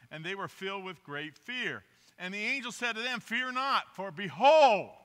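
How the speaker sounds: scripture reading. Acted. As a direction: read it out like the Christmas story from the pulpit. Reads Luke 2 aloud.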